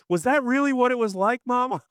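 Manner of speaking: put-on little kid voice; curious kid. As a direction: pipe up like a wide-eyed kid asking Mom. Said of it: "picture your kid looking at you".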